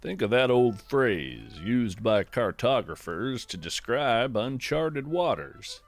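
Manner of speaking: ordinary delivery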